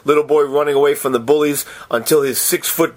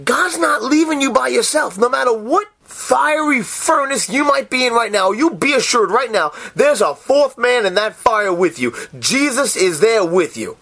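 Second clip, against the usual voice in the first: 1 fervent preaching; 7 semitones higher than usual. Real, not acted. Preaching at full force.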